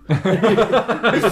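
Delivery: high loud burst of laughter; laughing. Real, not acted. Friend laughs at own mock Geordie line, laughing hard. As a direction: crack up at your own line.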